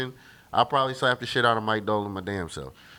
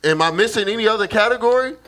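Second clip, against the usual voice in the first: each category punched louder and higher, building; rising heated list; 6 semitones higher, 5 dB louder than usual. Real, not acted. Arguing Kyrie stats with his friend, escalating heated shout. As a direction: hammer every stat home, getting louder.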